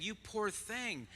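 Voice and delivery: high, soft, drawn-out pity; mock pity. Waitresses looking at the mother of eleven, mock pity. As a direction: coo it slowly in exaggerated sympathy.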